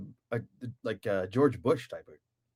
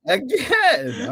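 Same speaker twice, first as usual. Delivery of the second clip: high, loud echo of one word; mock exclamation; 12 semitones higher, 4 dB louder than usual. Host echoing the synopsis punchline, high mock shout. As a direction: shout it back in jest.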